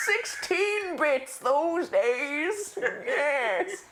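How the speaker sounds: very slow, high mock old-timer; old man. Host echoing guest's nostalgia, drawn-out mock old-man voice. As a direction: stretch it out like a hammed-up codger. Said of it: "I'm an old man".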